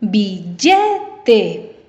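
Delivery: "Billete" in separated syllables, the middle one punched; stress on second syllable. Shown as a demonstration. Teacher asks students to find the stress, "Billete" with LLE stretched. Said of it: "Escucha dónde lleva la fuerza de voz".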